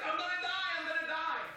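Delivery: high-pitched imitation of a terrified friend; retold panic. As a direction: mimic a dying man's frantic cry. Voices terrified dying friend.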